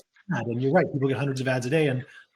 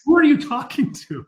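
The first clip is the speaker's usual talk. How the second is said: loud high retort; 13 semitones higher, 7 dB louder than usual. Retold or imitated. Truman Show husband's loud high retort to a pitch. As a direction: shoot the question back, loud and high. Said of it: "and he goes".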